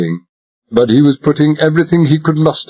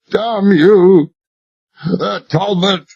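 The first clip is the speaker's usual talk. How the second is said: despairing agonized shout; 9 semitones higher, much slower than usual. Acted. Royal on his knees cries out to Talbot, agonized. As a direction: raise it high, a cry of agony. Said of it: "hoarse, despairing, agonized shout".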